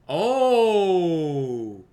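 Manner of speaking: loud, long falling "ohhh"; drawn-out "oh". Real, not acted. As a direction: let out a big, drawn-out exclamation. Player reacts while sneaking in.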